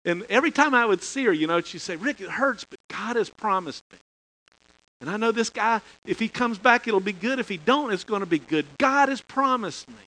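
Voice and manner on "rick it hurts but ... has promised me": higher and louder, a lightly voiced hopeful young woman; earnest confession. Student telling her minister God's promise holds, lightly voiced earnest. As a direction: insist on the promise, a bit lifted. Retold girl's faith confession.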